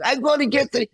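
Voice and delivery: put-on high, shouted drunken rant. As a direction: bellow like a drunk drummer hunting his jacket. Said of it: "I'm not doing it that great".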